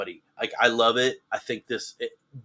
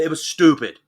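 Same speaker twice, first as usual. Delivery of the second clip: loud blunt verdict; 8 dB louder than usual. Real, not acted. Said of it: "No, I shouldn't say that".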